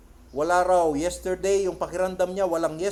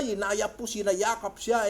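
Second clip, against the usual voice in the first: ordinary delivery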